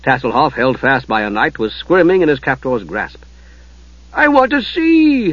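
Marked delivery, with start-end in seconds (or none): 4.1-5.3 high, slow pleading voice; begging kender. Acted. Kender begging his captor to let him see. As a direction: beg to be let go. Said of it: "the Kender begged".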